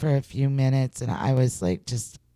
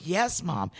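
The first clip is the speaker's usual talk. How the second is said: soft, slightly raised retold reply; small-voiced retort; 3 semitones higher, 7 dB quieter than usual. Daughter humoring her starstruck mom, soft retort. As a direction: drop it softly, humoring Mom. Friend's weary retort, acted.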